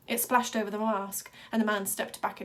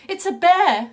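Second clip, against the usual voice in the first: much higher, loud frightened yell; panicked cry; 9 semitones higher, 15 dB louder than usual. A narrator's reading. A terrified audience member yelling at the blood-covered boy. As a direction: shriek it in panic.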